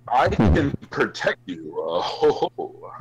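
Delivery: loud, hammed-up creepy invitation; Jimmer impression. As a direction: play the goofy protector with put-on chuckles. Acting out Jimmer's voice.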